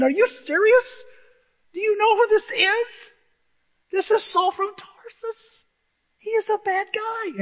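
very high squealed disbelief; hammed-up, high-pitched panic; incredulous protest. Ananias protesting to God about Saul, incredulous squeal.

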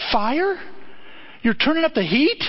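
high, rising disbelief; incredulous questioning. Acted. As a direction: question God as if you can't believe it. Acts David questioning God in disbelief.

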